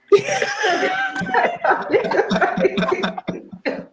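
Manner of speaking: bright burst of laughter; laughing. Real, not acted. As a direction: break into a real laugh. Public defender laughs at joke.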